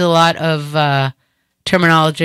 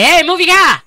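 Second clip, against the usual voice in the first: high, loud Boston yell; shouted demand; 11 semitones higher, 5 dB louder than usual. Acted. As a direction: holler it out the door, Boston-style. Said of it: "open the door and say".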